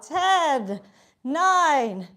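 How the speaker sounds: counting down loud and punchy; energetic countdown. Real, not acted. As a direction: call each number out to push them on. Calling out final countdown.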